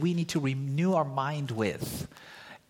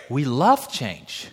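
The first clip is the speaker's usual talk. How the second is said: slow emphasis; 3 semitones lower, 3 dB louder, much slower than usual. Real, not acted. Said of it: "emphatic correction".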